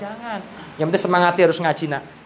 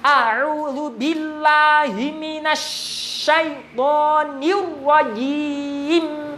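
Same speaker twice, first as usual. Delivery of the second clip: slow drawn-out tartil chant, higher; Quran recitation; 8 semitones higher, much slower than usual. Shown as a demonstration. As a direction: stretch each syllable like a reciter. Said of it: "Kita contohkan lagi".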